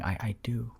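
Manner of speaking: very soft, whispery murmur; hushed mock sleep-talking; acted sleep talk. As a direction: pretend to murmur while fast asleep. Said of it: "I'm sleeping next to you".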